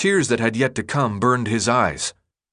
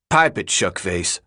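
grunted; 2 semitones lower than usual. A narrator's reading. Leader silencing his teasing friend, grunted. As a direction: grunt it at your mate. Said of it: "Albie grunted".

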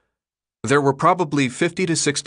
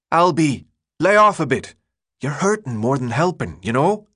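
put-on raised British-style accent; odd accent; 5 semitones higher, 3 dB louder than usual. A narrator's reading. Friend telling the leader to ease up, odd accent. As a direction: call your mate off, in a British-style accent. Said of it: "his voice thick with an odd accent".

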